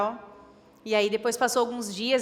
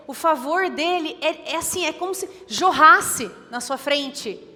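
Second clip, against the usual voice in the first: louder, higher preaching, driving each line home; fervent preaching; 5 semitones higher, 6 dB louder than usual. Acted. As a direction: preach it out with rising fire. Preaching swells again at close.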